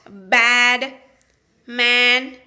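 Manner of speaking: bad and man with a short flat a; bad, man, the a as in cat; short a vowel. Shown as a demonstration. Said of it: "make the short vowel sound like in cat".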